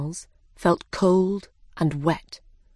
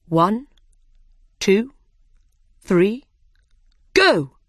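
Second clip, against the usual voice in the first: building countdown to a loud go; race countdown; 4 dB louder, slower than usual. A narrator's reading.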